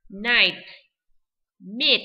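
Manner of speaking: loud clear "Night", then "meat"; teacher review drill; read again. Shown as a demonstration. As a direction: model "Night, meat" for students to repeat. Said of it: "chúng ta đọc lại nha".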